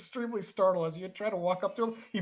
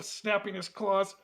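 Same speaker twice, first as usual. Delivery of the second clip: ordinary talk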